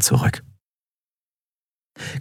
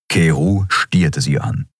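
usual speaking voice

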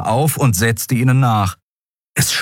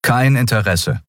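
firm voice; 3 semitones higher than usual. A narrator's reading. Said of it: "mit fester Stimme".

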